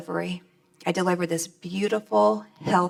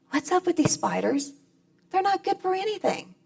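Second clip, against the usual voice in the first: high, whiny protest about spiders; David's complaint; 12 semitones higher than usual. Retold or imitated. David complaining to God about spiders, high whine. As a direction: whine up to God like a fed-up boy.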